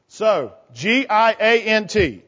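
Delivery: normal talking voice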